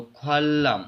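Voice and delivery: ख्वालम joined on one held L; blended L sound. Shown as a demonstration. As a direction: hold the ल in ख्वालम. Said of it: "एक ही लाम के साथ". (ख्वालम, एक लंबे लाम से)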